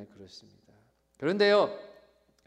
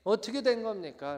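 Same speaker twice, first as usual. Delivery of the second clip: raised voice appealing to God; earnest, pitched-up complaint; pleading protest; 5 semitones higher, 6 dB louder than usual. Acted. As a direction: cry out to God for an explanation. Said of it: "항변하는".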